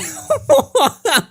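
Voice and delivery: short high laughing burst; high giggle. Real, not acted. Still reacting to the DM, a short high giggle.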